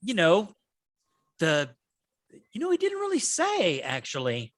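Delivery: lightly voiced goblin explaining himself; flustered minion. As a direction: fumble the excuse like a nervous underling. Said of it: "He goes".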